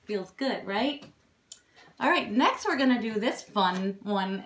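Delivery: ordinary talk, nothing marked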